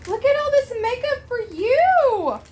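high, loud delighted gasp; excited gasp. Real, not acted. Mother opening a gift box for her daughter, thrilled. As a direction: squeal with delight at the makeup.

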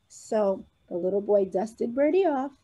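ordinary delivery, nothing marked

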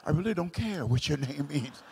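low, soft muttered aside; hushed, unimpressed thinking aloud; inner thought. Retold or imitated. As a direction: say it under your breath, as a private retort. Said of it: "in my mind, I'm like".

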